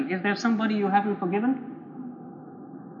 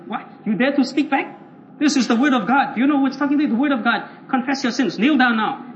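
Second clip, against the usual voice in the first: hammed-up outrage of a bullying evangelist; domineering rebuke; 3 semitones higher, 3 dB louder than usual. Zealot answers the man who dares speak back, bullying rebuke. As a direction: order him down with mock indignation.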